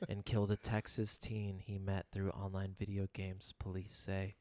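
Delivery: low, soft ominous narration; chills narrator voice. Shown as a demonstration. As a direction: read it like an unsolved mysteries host. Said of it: "the perfect chills voice".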